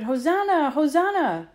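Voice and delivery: high, loud cry of praise; ringing, high-pitched acclamation; joyful crowd shout. A narrator's reading. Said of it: "They shouted".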